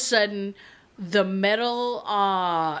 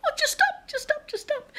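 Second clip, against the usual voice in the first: squeaky, much higher desperate begging; frantic pleading. Retold or imitated. Begging her car's awful noise to stop, squeaky and frantic.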